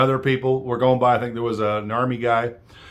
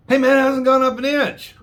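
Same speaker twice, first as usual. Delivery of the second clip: put-on high, dopey old-man voice; mock him, pitched way up and clueless; mocking Biden; 12 semitones higher, 3 dB louder than usual. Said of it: "You cannot make up this kind of stupidity".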